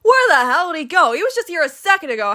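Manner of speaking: high, exasperated outburst; stunned disbelief. A narrator's reading. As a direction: demand it in acted bafflement. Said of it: "stunned silence, unable to process".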